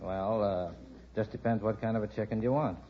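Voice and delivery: ordinary delivery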